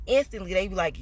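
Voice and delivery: ordinary talk, nothing marked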